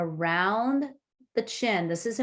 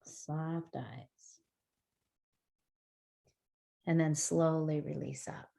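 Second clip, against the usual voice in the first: very soft, hushed cue; guided meditation; 4 semitones lower, 8 dB quieter, slower than usual. Acted. Teacher guiding the group's breaths in a hushed voice. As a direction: breathe the words out gently.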